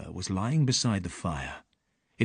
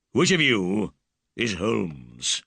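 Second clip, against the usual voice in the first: rude; slower than usual. Acted. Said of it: "he asked rudely".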